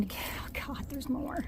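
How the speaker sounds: whispered while straining; breathless whisper. Real, not acted. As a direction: gasp it out mid-climb. Climbing boulders, out of breath.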